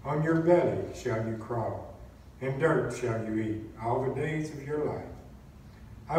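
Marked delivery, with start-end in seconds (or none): none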